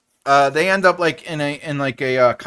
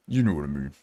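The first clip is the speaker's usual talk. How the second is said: much lower growly "You know what I mean."; low deep voice; 6 dB quieter, faster than usual. Pretend. Streamer playing with voices for chat, deep low. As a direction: drop it way down, silly. Playful voice series.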